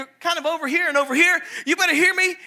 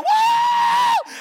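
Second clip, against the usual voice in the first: high whooping yell; whooping cheer. Retold or imitated. Guys cheering streaker.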